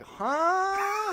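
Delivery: high, startled huh; a sudden lost question; confused huh. Retold or imitated. Own confusion at boar.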